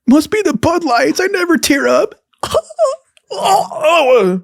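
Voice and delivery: high, squeaky laugh-crying; laughing through tears. Real, not acted. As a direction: laugh it off while tearing up, voice cracking high. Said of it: "Getting teared up right now".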